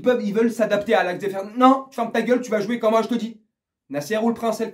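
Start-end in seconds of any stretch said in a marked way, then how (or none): 1.6-3.4 bossy order. Retold or imitated. Voicing a bossy club owner.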